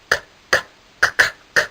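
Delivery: unvoiced clicking clatter; clanking keys noise. A narrator's reading. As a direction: click the tongue like jangling keys.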